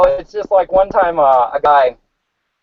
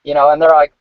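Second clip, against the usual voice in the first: normal talking voice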